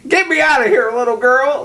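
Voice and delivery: high-pitched, hammed-up begging; imitating a trapped man's frantic appeal; mock desperate plea. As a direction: plead selfishly, as a put-on panic. Contrasted quote of trapped man pleading.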